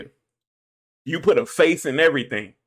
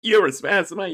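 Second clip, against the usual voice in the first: ordinary talk, nothing marked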